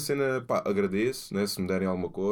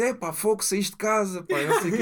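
imitating friend; 10 semitones higher, 3 dB louder than usual. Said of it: "Ele disse".

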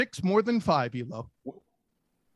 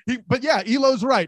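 ordinary talk

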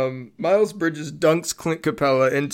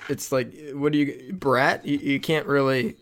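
usual speaking voice

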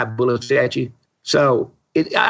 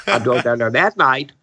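normal talking voice

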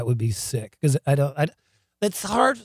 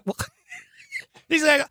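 normal talking voice

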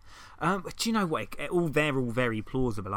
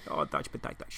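whispered mock longing; 10 semitones lower, 9 dB quieter than usual.

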